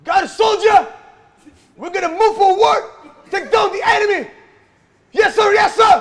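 shouted soldier movie lines; drill sergeant. Pretend. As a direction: bark orders like a war film hero. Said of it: "acting Hollywood".

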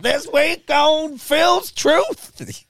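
hugely high, loud, slow mock announcer intro; conspiracy podcast host. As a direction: ham up a paranoid radio host opening his show. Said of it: "if I was to start a podcast".

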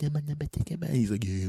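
high imitation of a woman's sweet chatter; put-on high female murmur; mock sweet voice. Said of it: "I could hear her sweet voice".

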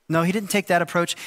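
ordinary delivery

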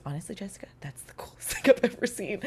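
hushed whisper to a colleague; whispered aside. Retold or imitated. Engineer whispering to Jessica in the studio, hushed. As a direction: lean in and whisper the praise. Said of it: "And he goes".

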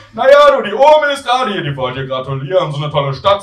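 mum starting high and loud, settling down; chattering mother. Acted. Mother passing on granny's congratulations, high start easing off. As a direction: burst in high, then ease towards normal.

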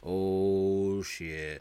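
long, slow imitated oath; drawn-out dread. Lieutenant seeing Hightower has heard, drawn-out dread. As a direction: stretch each word as trouble dawns. Onlooker sees trouble coming.

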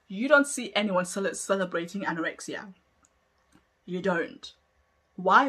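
ordinary talk, nothing marked

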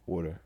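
soft, quick "Water" with hardly any mouth movement; barely moving mouth. Shown as a demonstration. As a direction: murmur "Water" through a nearly still mouth. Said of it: "barely even have to move it".

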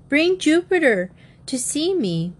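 high, eager request; insistent. A narrator's reading. Bobby asking Jim to bring the horse, insistent. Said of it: "Bobby insisted".

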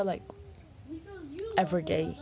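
normal talking voice

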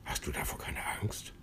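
fast, breathy whispered worry; anxious whisper. A narrator's reading. Plitsch fears the approaching beetle, asking in a fast whisper. Worried question about beetle.

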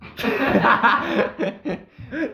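long burst of laughter. Real, not acted. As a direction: crack up hard at the interruption. Carcajada tras la interrupción. (carcajada larga y aguda)

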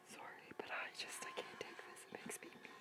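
breathy whisper to herself; barely voiced apology under the breath. Real, not acted. Whispering during tense horror scene.

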